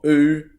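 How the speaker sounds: "oo" as the long vowel in boot; Middle English vowel O. Shown as a demonstration. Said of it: "which would sound like".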